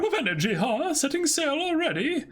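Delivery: high, teasing voice; high-voiced woman ribbing the departing pirates; cheerful woman. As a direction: tease the crew for leaving so soon. S1 voicing Lola greeting the crew.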